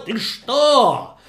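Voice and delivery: tremble with rage and shout him down; angry host yelling. Acted. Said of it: "и он закричал".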